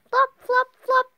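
high, loud, short flops; bouncy high-pitched footsteps; sound-effect words. A narrator's reading. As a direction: punch out each flop high and loud. Acting out Froggy's flopping steps.

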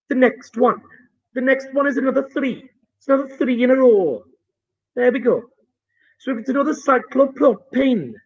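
put-on lightly done Welsh accent. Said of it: "I'm not very good at the Welsh accent".

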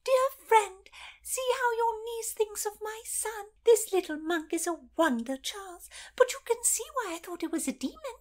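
put-on high, breathless whispered shriek of a frightened old lady; hammed-up high panicked old woman. Lady Paget voice held.

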